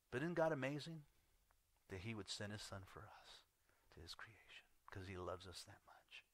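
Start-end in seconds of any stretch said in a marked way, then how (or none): 1.9-6.2 1 hushed. Real, not acted. Hushed awe about God's love.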